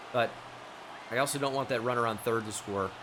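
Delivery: ordinary talk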